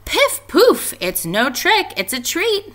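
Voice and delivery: witch voice. A narrator's reading. Teacher reading, Scraggy Witch pleading with the ghost. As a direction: insist it's a treat. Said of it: "said Scraggy Witch".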